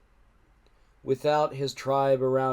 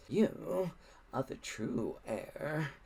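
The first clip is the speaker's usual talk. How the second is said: much softer, slow menacing villain; quiet menace; 3 semitones higher, 9 dB quieter, much slower than usual. A narrator's reading. Alvin to Hiccup, quietly menacing. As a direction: lean in and say it softly, slowly. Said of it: "said Alvin quietly".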